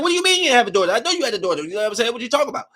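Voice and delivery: high, sharp disbelief; incredulous demand. Retold or imitated. New mother confronting the lady about her missing daughter. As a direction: push her, you don't believe a word.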